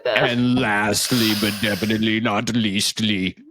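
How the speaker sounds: Arnold Schwarzenegger voice. Acted. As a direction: growl the finale like an action star. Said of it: "He just became Arnold Schwarzenegger".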